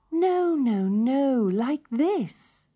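a small bird teaching; high fussy correction; high nightingale. Acted.

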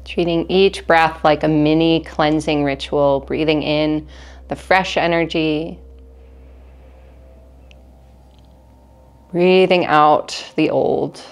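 calm, measured meditation guide with long pauses; guided meditation. Acted. Teacher leads listeners through breathing in an energy cleanse, calm guided meditation. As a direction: guide them gently through each breath, unhurried.

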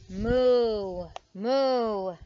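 two loud, drawn-out storybook moos; cow mooing. A narrator's reading. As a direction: moo it out like a cow. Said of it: "said the cow quickly".

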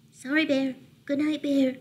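small, high mouse voice; whispering mouse. A narrator's reading. Mouse apologizing to wailing Bear, small high voice. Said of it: "whispered Mouse".

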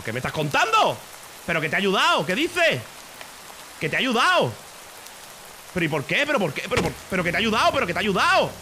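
loud, high-pitched mock outrage; indignant protest. Roleplayer accused by another player, indignant outburst. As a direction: protest in disbelief, voice jumping up.

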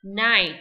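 loud clear model of "night"; teacher demo of "night"; model word. Shown as a demonstration. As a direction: say "night" crisply for the class. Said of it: "hiệp sĩ là night".

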